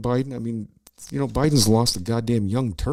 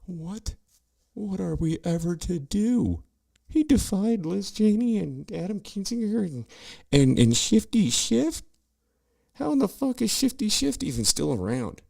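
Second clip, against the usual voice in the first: high, put-on fake gasping voice; mock the pearl-clutching committee fans; much higher, sneering falsetto; sarcastic mock shock; 13 semitones higher than usual. Mock outrage at the charge.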